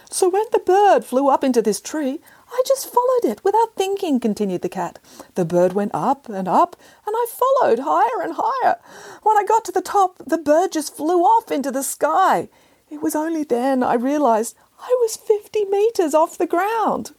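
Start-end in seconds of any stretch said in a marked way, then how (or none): 0.1-4.2 snooty bored cat. Acted. Cat character voiced. 5.3-17.1 snooty bored cat. Acted. Cat character voiced.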